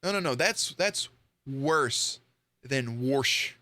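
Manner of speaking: ordinary delivery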